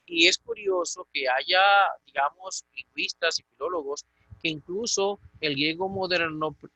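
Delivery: ordinary delivery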